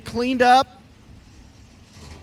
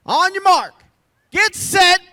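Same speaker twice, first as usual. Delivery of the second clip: shouting; 11 semitones higher, 9 dB louder than usual. Real, not acted. Starting a pit stop race.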